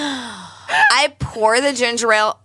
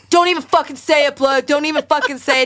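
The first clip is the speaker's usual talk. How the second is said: annoyed friend; 5 semitones higher than usual. Retold or imitated.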